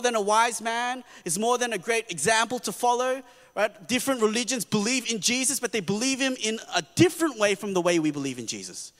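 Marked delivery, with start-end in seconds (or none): none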